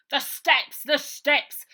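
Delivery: loud, high alarmed shout of a sergeant; urgent shout. Acted. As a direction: yell it out, pointing in alarm. Sergeant spots the pushed-away steps.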